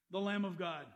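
ordinary talk

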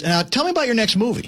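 lightly mimicked oblivious interviewer; Larry King. Co-host mimicking an interviewer who wasn't listening, obliviously moving on. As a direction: breeze on to the next question, pitched high. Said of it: "he doesn't even respond, and he goes".

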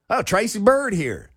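high, hammed-up Southern twang; mock country singer. Host mocking the singer's name in a high country voice. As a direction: play the country star showing off. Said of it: "I would sound good with a country accent".

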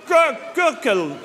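"Gur-, gurkle" spelled out slowly, syllable by syllable; slow sounding-out. Acted. Tourist slowly sounding out "gurkle" for the room service clerk. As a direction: drag out "gurkle" for a confused listener.

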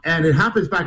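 normal talking voice

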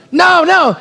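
high, emphatic cry of protest; alarmed refusal. Acted. Joseph fending off Potiphar's wife before he runs, alarmed. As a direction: cry out to fend someone off.

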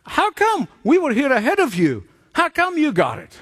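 high, indignant mock protest; hammed-up jealous candidates demanding why; furious applicants. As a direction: sound cheated and outraged. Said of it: "they were furious".

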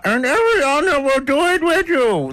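very high, squeaky ventriloquist voice; tiny high puppet answering for someone else; thrown puppet voice. Acted. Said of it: "trying to throw my voice like that puppeteer dude".

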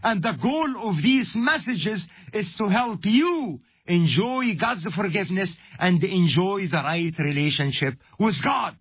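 ordinary talk

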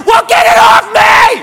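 high, loud, drawn-out yell; frantic comic shriek for help; mock panic. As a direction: shout it in hammed-up horror. Panicked man begs girlfriend for help.

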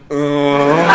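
put-on drunken wordless grunt; opilé zamručení. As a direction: grunt back as if too stiff to speak. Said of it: "úplně stuhlýho v šatně". (zamručet jako ztuhlý opilec)

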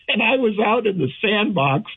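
high, laughing through the words. Real, not acted. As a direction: keep laughing as you speak. Still laughing from the previous line.

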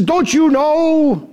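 high, slow taunting challenge; mock-stern Pilate. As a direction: lift it up like a boastful governor. Pilate scolding, voiced by preacher.

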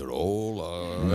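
low, drawn-out mock greeting; deep, lingering comic hello; kids imitating host's hola. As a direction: stretch "Hola" down like a parody radio host. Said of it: "Mis hijos lo hacen también".

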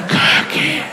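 breathy drawn-out whisper; whispering elf. Pretend. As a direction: whisper it like an elf announcing treats. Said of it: "now she's whispering their arrival".

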